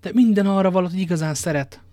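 high, loud protest; passionate insistence. Acted. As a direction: insist hotly, raising the voice. Lover insists she loves him. (hevesen, hangosan bizonygatva)

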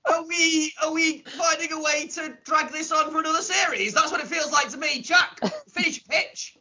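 exasperated. Real, not acted. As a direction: plead through laughter to get things moving. Exasperated host still laughing.

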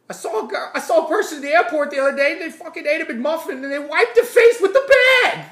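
high, loud, fast furious rant; Bill Burr rant. Retold or imitated. Bill Burr ranting about airport stranger, furious. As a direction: rant like an outraged comic. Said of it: "He's like".

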